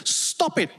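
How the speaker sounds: mild mock anger; imitating the boss's scolding; mock angry boss. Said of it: "he suddenly gets angry".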